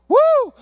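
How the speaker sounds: high, loud "woo"; praise whoop. Real, not acted. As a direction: let out an exultant whoop. Preacher whoops after story climax.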